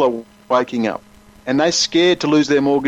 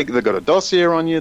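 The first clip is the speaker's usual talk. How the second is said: ordinary talk, nothing marked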